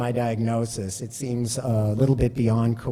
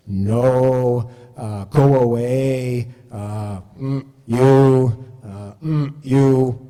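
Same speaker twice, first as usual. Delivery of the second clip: louder, mechanical machine voice; reading out the Terminator's response list like a machine; Terminator readout; 5 dB louder, much slower than usual. Pretend. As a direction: bark the options like a robot display. Terminator heads-up display responses.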